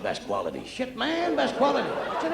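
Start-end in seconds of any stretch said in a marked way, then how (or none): 0.0-0.6 low, laughing drug-dealer voice; a hint of a dealer voice. Dealer offering the comic drugs at the airport, low. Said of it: "He said". 0.7-1.9 dealer voice. Retold or imitated. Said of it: "He said".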